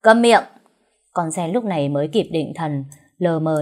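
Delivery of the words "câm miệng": loud, high, abrupt silencing; irritated command. A narrator's reading. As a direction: cut the maid off crossly. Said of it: "Cậu Minh nhíu mày bực dọc".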